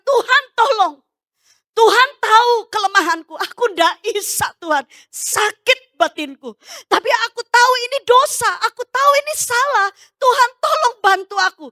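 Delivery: high, pleading re-enacted prayer; retold tearful cry. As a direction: cry out to God in anguish, acted. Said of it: "nangis jerit". (meniru doa sambil menjerit)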